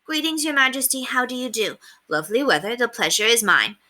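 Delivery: high, prim practice greetings; little boys trying to sound proper; boys rehearsing manners. Acted. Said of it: "practicing their bows and socially useful phrases".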